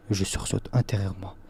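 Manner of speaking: plain reading voice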